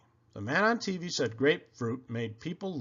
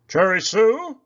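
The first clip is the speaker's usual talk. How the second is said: Poppleton voice; 7 semitones higher, 10 dB louder than usual. A narrator's reading. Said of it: "said Poppleton".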